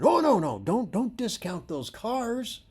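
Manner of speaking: high, urgent no no; teacher correcting a student eagerly; eager correction. Retold or imitated. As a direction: insist excitedly. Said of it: "And I said".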